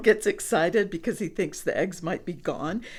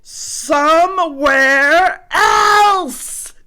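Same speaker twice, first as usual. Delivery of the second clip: high, loud exasperated shout; 7 semitones higher, 12 dB louder, much slower than usual. Acted. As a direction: yell it out at full force. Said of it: "Gerald shouts out".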